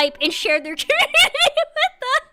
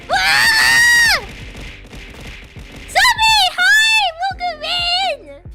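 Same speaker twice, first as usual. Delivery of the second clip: high, loud squeal of welcome; excited high greeting; 10 semitones higher, 5 dB louder, much slower than usual. Real, not acted. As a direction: greet them with a bright shout. Streamer greets new viewer.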